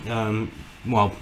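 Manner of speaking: usual speaking voice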